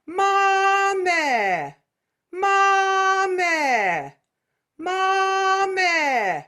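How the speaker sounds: "Mame" with an open, pure eh, no glide; vertical meh vowel. Shown as a demonstration. Teacher modelling the vertical eh vowel, high. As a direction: sing "Mame" as a flat meh. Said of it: "I am saying meh".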